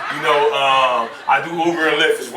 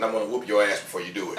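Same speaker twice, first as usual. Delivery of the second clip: lower, quicker mock mama threat; 6 semitones lower, 8 dB quieter, faster than usual. Mama warning her son before the whooping, low and quick.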